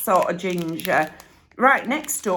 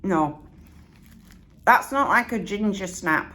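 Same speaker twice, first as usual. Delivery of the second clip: ordinary talk, nothing marked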